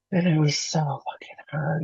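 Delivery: breathy whisper, words barely voiced; whispered despair. Real, not acted. A woman recalling her darkest night, whispering. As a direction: whisper it as if reliving the pain. Reliving the worst moment.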